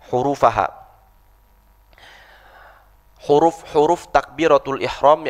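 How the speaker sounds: ordinary talk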